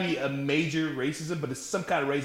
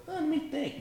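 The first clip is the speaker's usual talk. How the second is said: high, softer pretend deliberation; mock pondering; 12 semitones higher, 6 dB quieter than usual. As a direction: pretend to think it over, lightly and high.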